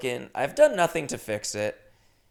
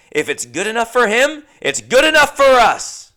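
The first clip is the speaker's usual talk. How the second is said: louder and higher, like a grand preacher's motto; mock proclamation; 10 semitones higher, 13 dB louder than usual. Host jokingly sells a Bible food as a preacher, grand mock proclamation. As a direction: proclaim it to the congregation, tongue in cheek.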